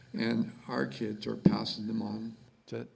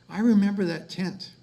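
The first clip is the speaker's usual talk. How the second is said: mom lighting up; 8 semitones higher, 6 dB louder than usual. Retold or imitated. Mom voiced, face lit up.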